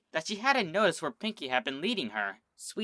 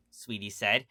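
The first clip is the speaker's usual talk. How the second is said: ordinary delivery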